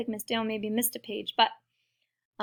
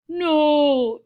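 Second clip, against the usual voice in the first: loud, high, long no; alarmed cry; 5 semitones higher, 10 dB louder than usual. Acted. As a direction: cry out as danger drops. Crowd scatters as he falls.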